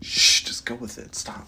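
hushed whisper aside. Real, not acted. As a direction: whisper it so she won't hear. Aside to girlfriend, hushing.

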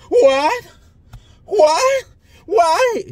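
high, loud, crying "what?" in mock disbelief; mock shocked disbelief. Mock fired stewardess's shock.

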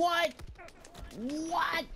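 loud, high disbelieving yelp; shocked shout. Real, not acted. Shocked gamer exclaims.

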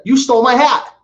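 angry woman at a garage sale accusing him, mock shout; mock accusation. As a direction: snap it at him like a cranky stranger. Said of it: "she's like".

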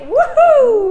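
a high, loud delighted "Woohoo!"; cheering whoop. Real, not acted. Host cheering a viewer's younger boyfriend, loud whoop. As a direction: whoop with joy.

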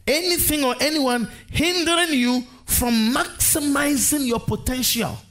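high, emphatic "Anything or anyone"; stress on ANYONE. Real, not acted. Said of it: "I put emphasis on anyone".

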